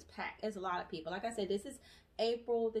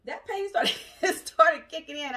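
laughing; 7 semitones higher, 10 dB louder, slower than usual. Real, not acted. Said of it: "that pain started kicking in".

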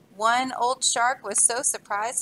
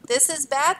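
raised, alarmed cry; 4 semitones higher, 6 dB louder than usual. Acted. Beavers adrift at sea cry out, alarmed. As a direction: call it out in alarm. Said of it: "they cried".

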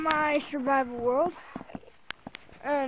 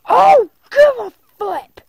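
very loud, high, slow outburst; loud, drawn-out defiant exclamation; 8 semitones higher, 16 dB louder than usual. Real, not acted. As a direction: blast it at the complainers. Snapping at imagined viewer complaints.

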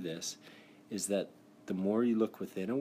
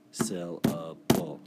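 syllables clapped out; 3 semitones lower, 5 dB louder, much slower than usual. Shown as a demonstration. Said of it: "There are three syllables".

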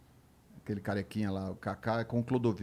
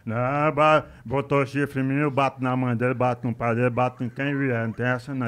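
mock furious boxer; 8 dB louder than usual. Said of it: "E o Maguila em paz".

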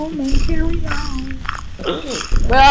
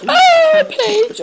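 excited; 13 semitones higher, 4 dB louder than usual. Real, not acted. Finds page in horror game.

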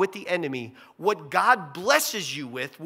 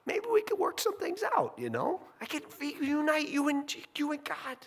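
much higher, sweetly hopeful mock Eve; naive Eve; 10 semitones higher than usual. Eve offers the serpent a deal, high naive mock. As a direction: play her as eager to patch things up.

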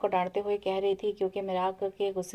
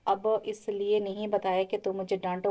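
plain reading voice

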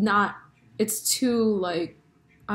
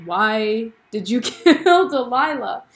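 slow, louder, laughing incredulous question; mock disbelief; 3 semitones higher, 5 dB louder, slower than usual. Joe asking Love why she killed Delilah, mock incredulous. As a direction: draw it out in amused disbelief. Retelling Joe's disbelief at killing.

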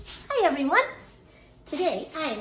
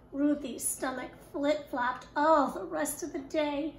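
ordinary delivery

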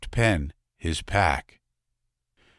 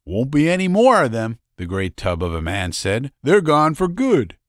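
boastful big man; 8 semitones higher, 5 dB louder than usual. Acted. Tub man boasting about the war.

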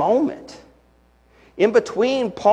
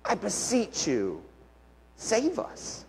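very soft desperate plea; hushed plea; 3 semitones higher, 11 dB quieter, slower than usual. Retold or imitated. Pastor voicing the crowd's true cry, hushed plea. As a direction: plead barely aloud. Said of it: "which is a word we should bring back".

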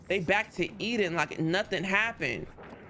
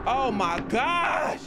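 drawn-out exclaim; 5 semitones higher, much slower than usual. Real, not acted. Relief after escaping enemies.

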